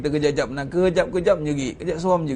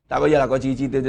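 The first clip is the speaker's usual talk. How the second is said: ordinary talk, nothing marked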